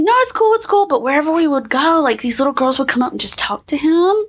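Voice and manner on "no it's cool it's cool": high, quick anxious no it's cool; nervous reassurance. Retold or imitated. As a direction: brush it off nervously. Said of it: "He's like".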